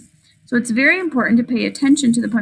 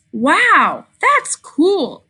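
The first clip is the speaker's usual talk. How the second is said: high, excited wow and cool; 6 semitones higher, 3 dB louder, much slower than usual. Acted. Child narrator amazed at dad's fact, excitedly. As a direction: exclaim it with wonder, high. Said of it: "I said".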